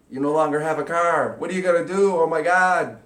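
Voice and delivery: loud, high, panicked wail; hammed-up despair over the lost car; frantic mock alarm.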